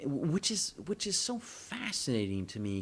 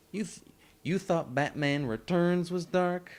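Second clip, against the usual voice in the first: sassy; 8 semitones higher, 8 dB louder, slower than usual. Real, not acted. Sassy rhetorical challenge.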